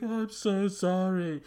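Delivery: high, slow, loud whine; hammed-up tearful apology; mock crying. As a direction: wail the apology in mock tears. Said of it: "Such a cry".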